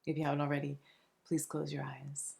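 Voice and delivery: soft, soothing meditation voice with long pauses between phrases; unhurried, hushed relaxation guide; guided meditation. Acted. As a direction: gently guide them into calm, phrase by phrase.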